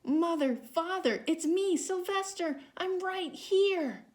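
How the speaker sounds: high, loud, pleading cry of a lost son; silent shout. A narrator's reading. Boy trapped as a rock crying out to his parents, high and pleading. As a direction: call out to his parents with all he has. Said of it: "How he wanted to shout".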